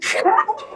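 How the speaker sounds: high giggle after a story; high laugh. Real, not acted.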